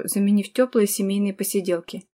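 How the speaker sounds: ordinary delivery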